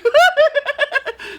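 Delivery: very high giggle bursting out; wife's giggle. Acted. The wife sees the bat, high giggle. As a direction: let the laugh burst out high.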